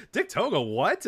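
high start, incredulous "what"; baffled tweet. Retold or imitated. Speaker reads out fans' tweets, baffled. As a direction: imitate a bewildered reader's double take.

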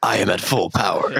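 villain voice breaking into laughs; anime villain voice, laughing. Acted. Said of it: "like an anime villain".

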